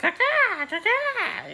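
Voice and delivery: very high squeaky dragon voice, sniffing out a scent. Acted. Dragon Caraxes sniffing out the thief, very high squeaky voice. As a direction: play the dragon as a shrill cartoon sniffer.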